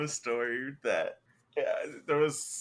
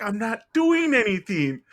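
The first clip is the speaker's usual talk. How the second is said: high, loud retold protest; indignant protest; 7 semitones higher, 6 dB louder than usual. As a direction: replay a wrongly accused pupil's outcry. Protests innocence to accusing teacher.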